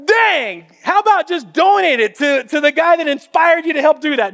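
loud, high joking outburst; loud mock outburst at friends; mock exasperated retort.

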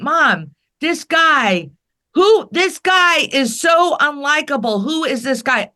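put-on a kid whining to mom about the man on TV; kid's disgusted complaint. Child remembering Trump in eighties.

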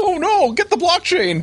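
high, loud panicked cry; action movie panic. Pretend. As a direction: shout it like a cornered hero. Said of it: "nineties action movie".

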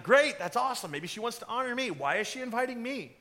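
high, gushing self-congratulation; hammed-up pleased wondering; flattered Haman. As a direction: play a villain delighted at being invited. Acts Haman flattered by invitation.

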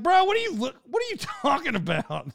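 Bro, really? Half offended, half laughing; incredulous outburst. Retold or imitated. Teller to the speaker who hinted he's chubby, high incredulous laugh. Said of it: "I'm like".

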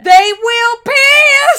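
high, slow hammed-up yell; drawn-out comic shriek; mock shout. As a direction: bellow it like a scandalized outburst. Mock-outraged shout over gossip.

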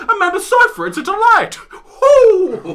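high, loud, laughing starlet; gushing celebrity voice. Pretend. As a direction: rave like a giddy actress. Mock celebrity interview.

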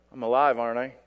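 low, deadpan grumble back; mock lifeless reply. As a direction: answer as if barely alive. Mock lifeless answer to greeter.

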